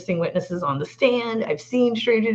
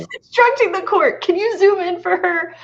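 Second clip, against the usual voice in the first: amused disbelief; 12 semitones higher, 5 dB louder than usual. Real, not acted. Amused retelling of witness bossing court.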